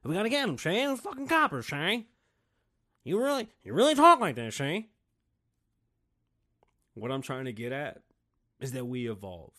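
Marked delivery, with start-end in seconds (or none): 0.0-4.9 put-on high-pitched old-time gangster voice; hammed-up crook from a bygone era; old-timey talk. Gangster telling Shane about the coppers, old-timey voice. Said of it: "People used to talk like this".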